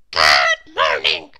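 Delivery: very low, loud little gentleman's greeting; a very low, loud polite good morning; polite Mister Small. Acted. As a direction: raise your hat with a deep, booming politeness. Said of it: "he remarked politely".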